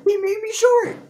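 high, alarmed squeal; startled yell. Real, not acted. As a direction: cry out in dismay at the game. Shocked game reaction to shrinking.

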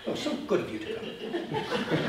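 mock-polite British accent in a hushed voice. Shown as a demonstration. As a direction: play up the British accent, half under your breath. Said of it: "I always do that with a British accent".

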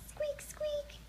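tiny, very high, soft squeaking; piglet squeaks. A narrator's reading. Sleepy piglets yawning in a bedtime story, high soft squeaks. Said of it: "yawned the piglets".

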